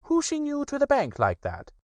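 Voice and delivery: high, pleased rabbit voice; high self-satisfied Rabbit. Acted. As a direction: Rabbit taking credit, high. Rabbit explains.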